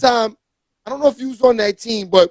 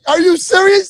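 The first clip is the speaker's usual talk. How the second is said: shocked disbelief; 7 semitones higher than usual. Retold or imitated. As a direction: call it out in shock. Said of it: "I was like".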